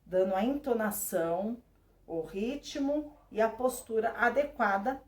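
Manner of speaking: usual speaking voice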